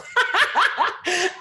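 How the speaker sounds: loud, high-pitched cackle; real laughing fit. Real, not acted. Host after a botched accent, loud helpless laughter. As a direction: burst out laughing at yourself.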